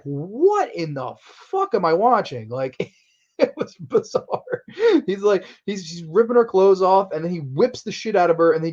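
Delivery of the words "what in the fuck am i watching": slow, drawn-out incredulity, pitched up; re-enacted slow-motion astonishment; bewildered disbelief. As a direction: stretch it out, utterly baffled. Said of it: "I was like".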